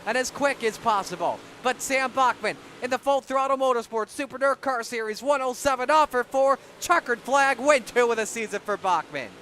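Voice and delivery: excited race call, fast and loud; play-by-play. Acted. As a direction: call the checkered flag live. Live race call finish.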